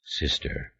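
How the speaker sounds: soft, almost whispered; said quietly. A narrator's reading. Brother answering his sister, quiet and soft. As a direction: murmur the word gently. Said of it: "I said quietly".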